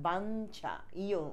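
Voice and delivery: বাঞ্ছা with the n held in the nose, then chha; বাঞ্ছা drawn out on its nasal; slightly nasal. Shown as a demonstration. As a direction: send the half sound of বাঞ্ছা through the nose. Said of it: "এরকম নাকে একটু উচ্চারিত হবে".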